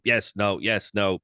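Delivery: ordinary delivery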